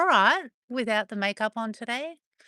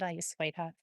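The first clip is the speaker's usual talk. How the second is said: tender; 4 semitones lower, 8 dB quieter than usual. Real, not acted. Soothing a reluctant dog.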